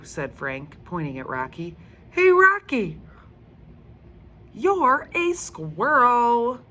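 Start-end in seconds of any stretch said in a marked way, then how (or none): 2.1-6.6 put-on high, loud, slow teasing kid; teasing boy. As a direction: sing it out to tease a friend. Frank teases his friend.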